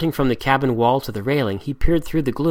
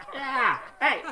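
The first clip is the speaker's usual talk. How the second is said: much higher, giggling mock insult; laughing mimicry; 14 semitones higher, slower than usual. A narrator's reading. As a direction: parrot the captain while laughing. Pirates mimic Redbeard laughing.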